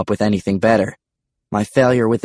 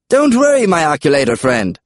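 high, loud dinosaur call; comic creature calling reassurance; dinosaur Charles; 6 semitones higher, 4 dB louder than usual. A narrator's reading. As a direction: call out high, dropping as it ends. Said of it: "Charles called".

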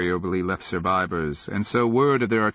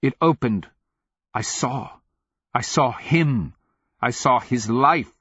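higher, halting outburst; bewildered fury; 6 semitones higher, 4 dB louder than usual. A narrator's reading. Telling the wizard what she saw, bewildered fury. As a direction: push each short phrase out in baffled anger. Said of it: "her voice cracking about a bewildered fury".

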